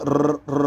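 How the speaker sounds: ر trilled with tongue on the ridge behind the teeth; tongue-to-palate trill. Shown as a demonstration. Teacher isolating the sound, ر trilled alone. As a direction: roll a bare ر against the palate. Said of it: "دانتوں کے پیچھے اوپر تالو میں لگائیں".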